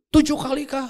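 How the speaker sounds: high, loud eager guess; eager blurt. Retold or imitated. Peter blurting his guess to Jesus, eager and loud.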